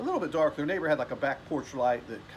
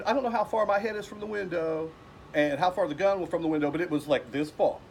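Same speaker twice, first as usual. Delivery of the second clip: ordinary talk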